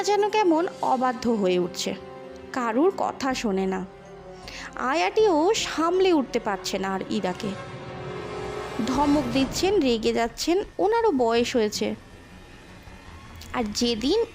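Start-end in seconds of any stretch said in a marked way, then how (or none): none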